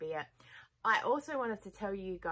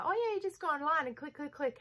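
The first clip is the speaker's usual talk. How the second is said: much higher, breezy voice of the bank clerk; helpful bank guy; 9 semitones higher, 3 dB louder than usual. Retold or imitated. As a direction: play the clerk making it sound easy. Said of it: "And he's like".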